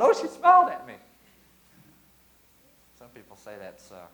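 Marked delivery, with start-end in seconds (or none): 0.0-1.0 high, loud doting gush; delighted gush. Retold or imitated. Father when his baby smiled, high delighted gush. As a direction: melt with joy. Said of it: "When she smiled".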